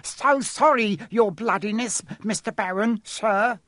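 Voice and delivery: high, fawning poltergeist voice; greasily. A narrator's reading. Peeves flattering the Baron, greasy and servile. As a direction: grovel oilily in a very high voice. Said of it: "he said greasily".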